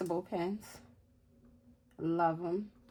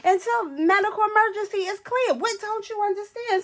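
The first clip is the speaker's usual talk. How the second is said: much higher, louder stern attendant voice; bossy flight attendant; 13 semitones higher, 5 dB louder than usual. Retold or imitated. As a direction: bark orders like a strict kindergarten teacher.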